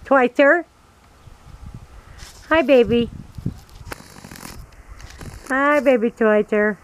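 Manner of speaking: high, loud pet-greeting voice; talking to a pet. Real, not acted. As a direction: call sweetly to the cat. Greeting her cat.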